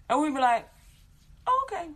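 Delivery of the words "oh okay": put-on high, meek little-kid okay; child agreeing. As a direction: answer like an obedient small child. Children answering grandma.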